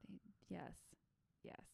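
soft whispered aside; whispering. Real, not acted. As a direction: whisper it under your breath. Aside near the cats.

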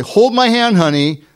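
put-on high, sing-song hold my hand to a toddler; lightly voiced gentle parent; sweet parent voice. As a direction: coax a little child lightly. Parent calling to small child.

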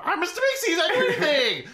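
high, eager cartoon helper voice, laughing through it; Mister Meeksy voice. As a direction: squeak it up like a chipper little helper who exists to serve. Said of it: "and they're fi".

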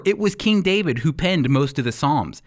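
ordinary talk

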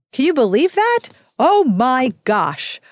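exasperated disbelief. Real, not acted. Annoyed reaction to book's ending.

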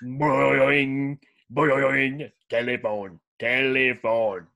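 alien sounding out a telephone; drawn-out alien voice on telephone; Yip Yip alien voice. Retold or imitated. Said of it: "the yip yips".

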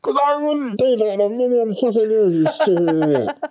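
high, laughing indignant character; muffled character voice. Retold or imitated. Character in the green room mocking the writer, high and laughing. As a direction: play a fed-up character shouting through the wall. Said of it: "the muffled voice from the green room going".

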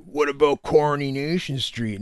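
slow, drugged-up teasing; mock methadone nod. Mocking his buddy at the breakfast table, druggy drawl. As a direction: imitate a man drifting off on methadone. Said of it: "he's methadoning out".

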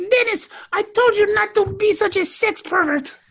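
high-pitched mock Turkish accent. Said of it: "good Turkish accent or not".

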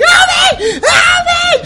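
frantic high-pitched shrieks; screaming for help. Acted. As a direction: scream it to the whole street, full volume. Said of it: "screaming again".

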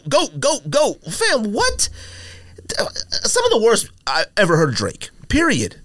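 ordinary delivery

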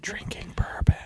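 whispered teasing aside. Real, not acted. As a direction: lean in and whisper it slyly.

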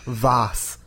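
Vase said as vahz with a broad ah; mock British vahz; British pronunciation. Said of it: "You're right, it is Br-, British".

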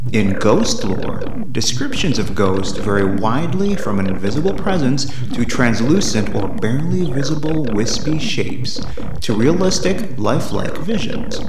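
slow, low narrator read; documentary narration. Acted. Reads encyclopedia intro over music.

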